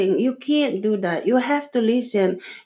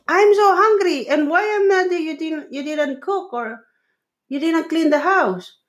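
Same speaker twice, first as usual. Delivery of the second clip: complaining husband; 6 semitones higher than usual. Retold or imitated. Husband voiced complaining angrily.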